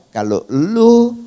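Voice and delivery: loud, high, drawn-out retold warning; God's warning. God warns the speaker against disobeying, loud slow warning. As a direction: stretch it out loudly as an imitated warning. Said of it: "Tuhan juga bilang".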